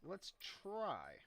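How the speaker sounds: "try" drawn out on a high pitch that falls away; stress on "try". Real, not acted. Gamer hedging before summoning enemies, stress on "try". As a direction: lean hard on "try", swooping down from high. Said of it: "I'm gonna emphasis the word try".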